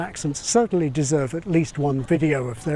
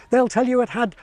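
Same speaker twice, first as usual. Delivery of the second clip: ordinary talk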